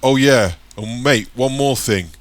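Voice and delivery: loud high call down the road; shouting after him. A narrator's reading. Gang member calls after departing Michael, loud shout. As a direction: holler it after him as he leaves. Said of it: "shouts one of the gunroom".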